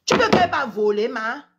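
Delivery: ordinary talk, nothing marked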